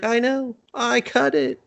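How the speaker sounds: high, put-on villain voice; mock villain line. Vampire smugly answers Vincent, high mock voice. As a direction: tease it like a smug vampire. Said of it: "He's like".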